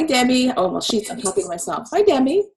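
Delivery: ordinary delivery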